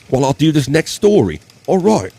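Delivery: loud, high mock-Aussie; Australian accent. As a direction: belt it out in a put-on Australian accent. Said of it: "my Australian accent anymore".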